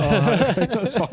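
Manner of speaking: high, loud, laughing through it; laughing reply. Real, not acted. Angler recalls the bait order reply, laughing. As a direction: laugh off the shock. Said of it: "I was like".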